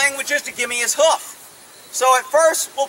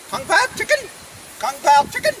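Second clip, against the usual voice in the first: mock Chinese; 3 semitones higher than usual. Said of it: "knows back in all these languages".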